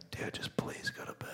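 desperate whispered begging; pleading whisper. Retold or imitated. Begging a toddler at night.

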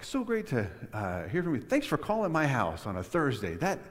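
high, hammed-up gratitude easing off; mock gushing. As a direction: pour on fake eager thanks. Continues the imagined gushing reply.

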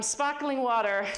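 put-on Australian accent, second try. Lecturer retries the Australian accent for the audience. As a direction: lean into the Australian vowels of sparkling water. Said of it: "Oh, that was pretty good".

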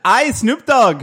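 high, loud yelped tag; a hammed-up high ad-lib. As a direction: yelp it like a rap ad-lib. Mock rap tag.

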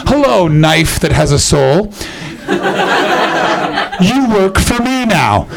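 slow, creepy villain voice; mock sinister. Comic talking to a soulful knife, slow mock menace.

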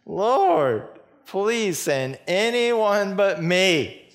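high, loud, slow begging; drawn-out whining plea; pleading Moses. Acted. Moses begging God to send someone else, high and slow. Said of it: "Moses again pleads".

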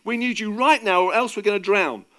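loud, high, urgent shouting; panicked, insistent appeal; desperate pleading. A narrator's reading. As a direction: beg for rescue over the storm. Peter pleads as boat is sinking.

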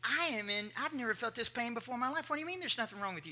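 indignant protest. Retold or imitated. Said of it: "I said".

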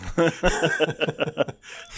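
real high, loud cackling laugh. Real, not acted. Man amused at his comeback to the manager, real laughter. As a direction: burst out laughing at your own story.

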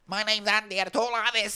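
high, put-on comedian voice; no-neck comedian impression. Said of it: "he talks like this".

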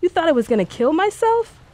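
high disbelieving question; incredulous high challenge. A narrator's reading. Incredulous retort.

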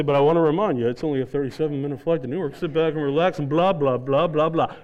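low, level captain's announcement; lightly voiced pilot announcement. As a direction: reel it off like a droning pilot. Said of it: "he goes on generously".

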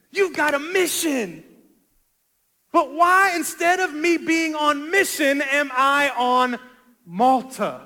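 impassioned preaching. Acted. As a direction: plead with God at full preaching force. Preaches at full force.

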